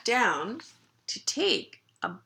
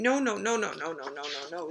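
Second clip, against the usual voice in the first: string of noes tailing lower and softer; protesting puppy; 4 semitones lower, 4 dB quieter, faster than usual. Acted. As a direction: keep refusing as the puppy, running down. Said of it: "he says".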